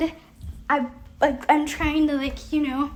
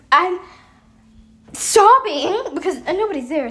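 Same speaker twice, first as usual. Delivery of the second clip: ordinary delivery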